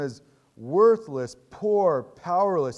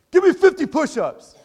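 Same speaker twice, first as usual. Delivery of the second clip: barking drill sergeant shout, much higher and louder; 12 semitones higher, 13 dB louder than usual. Retold or imitated. Old drill sergeant ordering a soldier in battle, shouted. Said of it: "and goes".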